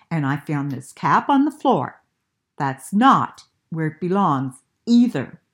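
annoyed father. Acted.

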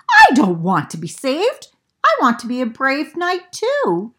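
indignant Beaver. Acted. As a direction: insist hard on being a knight.

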